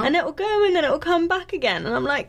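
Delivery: normal talking voice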